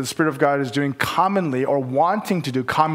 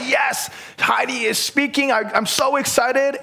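mock excited cheer, pitched up; eager fan; 9 semitones higher than usual. Young fan at the conference cheering for Heidi, pitched up. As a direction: gush like a fan seeing her hero. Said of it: "we're like".

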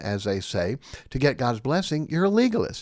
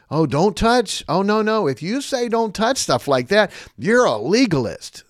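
hammed-up mock, louder and higher; mocking quote; 6 semitones higher, 6 dB louder than usual. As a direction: parody their line with raised voice. Mocking opponents' claim.

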